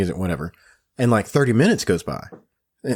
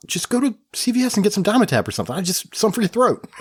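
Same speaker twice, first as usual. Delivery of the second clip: higher, fast, offhand; doctor brushing off the cough, fast and higher; dismissive brush-off; 7 semitones higher, faster than usual. Retold or imitated. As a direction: toss it off like it doesn't matter. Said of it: "And he goes".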